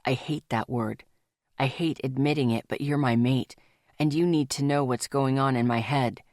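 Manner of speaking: low male lover's voice, hesitant and vulnerable; Rafe, lover, confessing fear. Acted.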